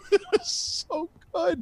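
high squeal through laughter; helpless laughter. Real, not acted. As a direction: barely get it out, slow and squeaky with laughing. Cracking up at the tweet.

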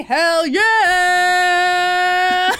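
high, loud whoop of joy; gleeful cheer. Real, not acted. Podcast host cheering the case news with a loud whoop. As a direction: cheer it like a crowd.

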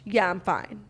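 ordinary talk, nothing marked

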